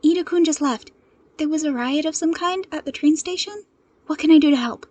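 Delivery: put-on urgent, serious young man; quick, earnest offer of help; fast and serious. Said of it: "He was talking fast, and his voice was serious".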